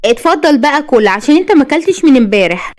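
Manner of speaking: high-pitched mild huff; grudgingly caring, a bit put out; annoyed. A narrator's reading. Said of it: "وبتقول بضيق". (بضيق)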